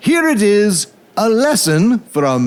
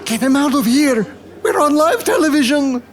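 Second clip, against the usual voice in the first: fast, higher panicked orders; 8 semitones higher, faster than usual. Acted. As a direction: hurry them off in a panic. Panicked host on live TV.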